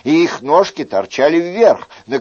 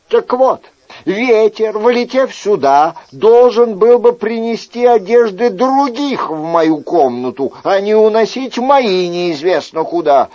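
higher, louder mock-patient reasoning; reasoning Chicot; 7 semitones higher, 4 dB louder than usual. Chicot lecturing the innkeeper with mock patience, higher and louder. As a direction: lecture the host step by step.

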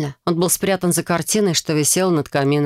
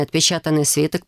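ordinary delivery, nothing marked

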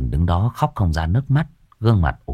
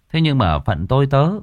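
usual speaking voice

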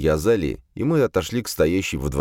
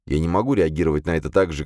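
ordinary delivery, nothing marked

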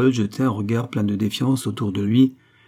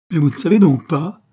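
high, louder protest; vehement; 7 semitones higher, 8 dB louder than usual. A narrator's reading. Concepcion swears her oath is sacred, vehement. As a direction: press the point with vehemence. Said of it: "fit-elle avec véhémence".

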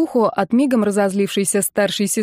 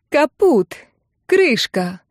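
doomed outburst; 5 semitones higher, 3 dB louder, much slower than usual. A narrator's reading. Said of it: "подбирала синонимичные слова на одну и ту же букву Ирка".

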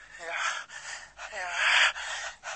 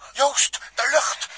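higher, louder, slow alarmed cry; urgent cry; 8 semitones higher, 7 dB louder, much slower than usual. Acted. Seeing his friend's air run out, an urgent cry. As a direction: call out in sudden alarm.

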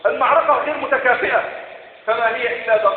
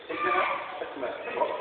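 breathy whisper of the name, held back; hushed, fast reveal whispered to the hall; whispered answer; 2 semitones lower, 13 dB quieter, much faster than usual. Acted. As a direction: whisper إنها أسماء as a reveal. Rhetorical answer to the congregation.

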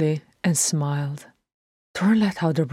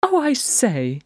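high, bright-eyed exclamation of delight; exclaiming; 10 semitones higher than usual. Acted. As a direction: pitch it up in sudden delight. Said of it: "he exclaimed".

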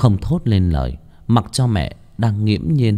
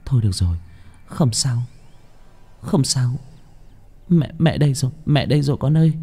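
soothing mother; 4 semitones higher than usual. A narrator's reading. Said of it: "Mẹ cứ ôm chặt nó trong lòng".

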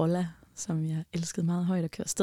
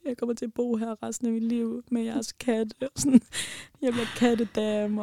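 lightly voiced pathetic wail, a bit higher; mock self-pitying whine; 3 semitones higher than usual. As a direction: play up the misery as a joke. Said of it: "Rigtig ynkelig".

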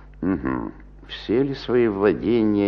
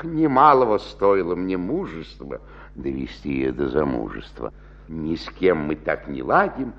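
usual speaking voice

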